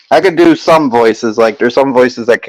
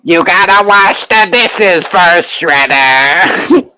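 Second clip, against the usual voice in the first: lightly voiced Krang scolding Shredder; 4 semitones higher, slower than usual. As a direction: nag Shredder in a mild Krang voice. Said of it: "I'm probably running out on that voice".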